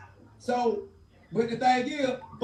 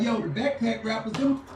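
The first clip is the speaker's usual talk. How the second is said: retold quote; 7 semitones higher than usual. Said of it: "He was like".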